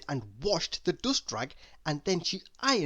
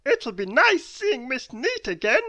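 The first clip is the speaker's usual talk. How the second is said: high, louder, chuckly silly-man voice; a pleased little chuckle in a high, loud voice; chuckling Mister Muddle; 9 semitones higher, 7 dB louder than usual. A narrator's reading. Mister Muddle walks home pleased with himself, chuckling. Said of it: "he chuckled to himself".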